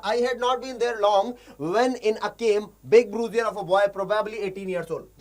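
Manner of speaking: slow, deliberate reading of English text; slow story reading. Shown as a demonstration. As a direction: read each word out slowly for learners. Teacher reads story text aloud.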